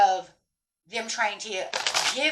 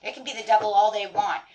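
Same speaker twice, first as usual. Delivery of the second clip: ordinary delivery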